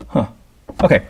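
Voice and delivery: ordinary talk, nothing marked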